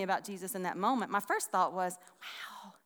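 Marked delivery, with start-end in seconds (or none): 2.2-2.7 hushed, breath-only wow; awed whisper. Retold or imitated. Preacher recalls first thought on reading this, awed whisper. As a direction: whisper it in amazement.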